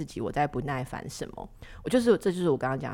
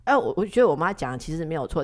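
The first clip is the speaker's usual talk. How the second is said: ordinary talk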